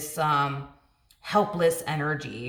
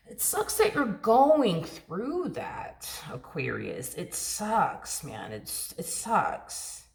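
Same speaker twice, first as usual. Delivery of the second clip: drawn-out, swooping show of pity; stretched-out, insincere commiseration; mock sympathy; much slower than usual. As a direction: offer hollow sympathy, very slowly. Imitates an unhelpful, unconcerned friend.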